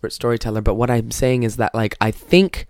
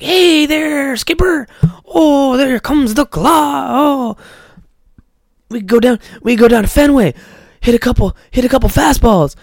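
much higher, sing-song goofy stepdad voice; goofy happy idiot; 11 semitones higher, 4 dB louder than usual. Retold or imitated. Liar Liar stepdad to his stepson, goofy cheery. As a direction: play a relentlessly cheery dope. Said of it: "such a goofy, fucking happy idiot".

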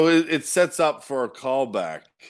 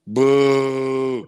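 mock boo; 4 dB louder than usual. Shown as a demonstration. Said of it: "So it's shit like that".

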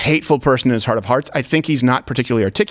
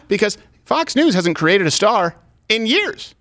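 ordinary talk, nothing marked

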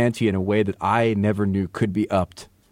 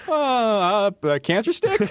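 put-on higher, hesitant kid voice; timid rookie; 8 semitones higher, slower than usual. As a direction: ask nervously like a kid. Voicing nervous rookie.

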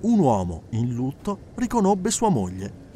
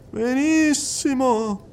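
slow, high drawn-out approval; medium's voice; 10 semitones higher, much slower than usual. Acted. Medium approving the woman's nod, slow and high. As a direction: savour the word.

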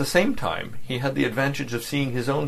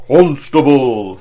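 huge, slow, much louder call across the square; a great drawn-out bass shout; booming call; 5 semitones higher, 12 dB louder, much slower than usual. Acted. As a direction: boom one word out the window effortlessly. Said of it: "with no more effort than if he had been whispering".

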